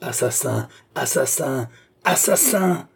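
escalating outraged yell; vociferating. A narrator's reading. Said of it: "vociféra la jeune femme".

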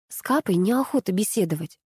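ordinary delivery

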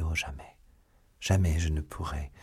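ordinary delivery, nothing marked

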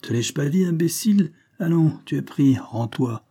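gloating cop, voice up and loud; mocking triumph. A narrator's reading. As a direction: crow over the trapped convict. Policeman mocks trapped convict.

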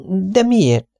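high, gentle lady's voice; tired young wife. Acted. Mrs Tenius refusing food from Miss Lawson, high and polite. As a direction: decline politely, worn out.

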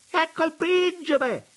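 lady on the terrace crying out that the prince has come; excited exclamation. A narrator's reading. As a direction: call out the prince's arrival with delight. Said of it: "esclamò donna Sara".

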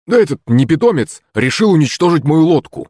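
high-pitched aggrieved grumble; mildly grumpy, high-voiced tattling; grumbling. A narrator's reading. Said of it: "пробурчал Захарыч".

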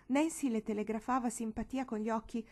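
usual speaking voice

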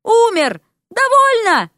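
put-on cornered young man squeals in anger; shrill shout. A narrator's reading. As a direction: yelp it out like someone stepped on your foot. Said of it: "получился визг".

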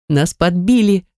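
ordinary delivery

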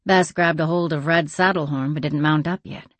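ordinary delivery, nothing marked

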